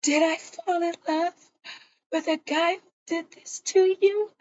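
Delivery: high, tearful imitation of a crying girl. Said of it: "Nandi's crying".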